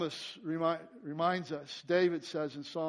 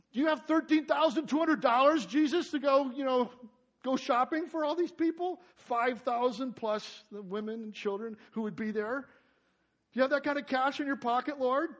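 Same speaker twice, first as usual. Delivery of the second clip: mock sarcastic challenge, much higher and louder; 8 semitones higher, 8 dB louder than usual. Disciples mocking Jesus over money, sarcastic. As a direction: needle Jesus about the cost. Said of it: "pretty sarcastic, pretty arrogant question".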